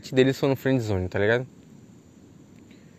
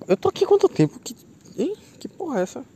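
surprised; 13 semitones higher than usual. Real, not acted. Realizes how long he's talked.

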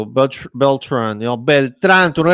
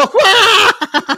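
laughing shout; 7 dB louder than usual. Real, not acted. Reacting to the insult while laughing.

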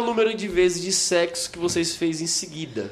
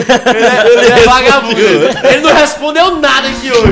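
teasing shout; 5 semitones higher, 10 dB louder than usual. Real, not acted. Teasing a guest who dodged.

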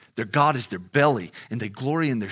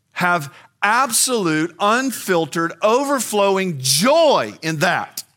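higher, louder, slower build on each word; rising fervour; 8 semitones higher, 7 dB louder, slower than usual. Real, not acted. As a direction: lift it into overflowing joy. Preacher exalting joy in Christ.

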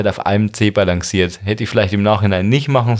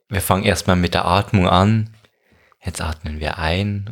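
guided meditation voice; 4 semitones lower than usual. Retold or imitated. Parodies guided meditation app.

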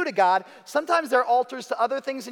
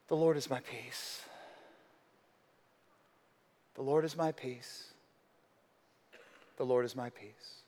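hushed reverent repetition; 7 semitones lower, 10 dB quieter than usual. Acted. Pastor echoing Gideon's altar name, hushed and prayerful. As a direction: say it like a quiet prayer to yourself.

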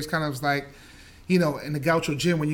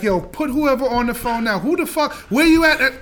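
higher, louder, a hammed-up raging mother; mock furious mother; 7 semitones higher, 5 dB louder than usual. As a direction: play the mom ready to go berserk. Furious Jamaican mother on phone.

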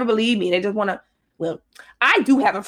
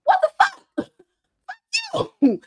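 very high squealed cursing, breaking into laughs; retold outraged squeal; slower than usual. Replaying how she went off on him, squealed outrage. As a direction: squeal it in mock fury, laughing between. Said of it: "I just went off".

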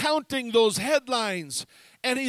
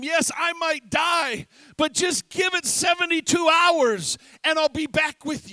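louder, ringing declaration; bold proclamation; 4 semitones higher, 6 dB louder than usual. Retold or imitated. Jesus promising his return to Peter, bold and loud. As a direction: proclaim it boldly.

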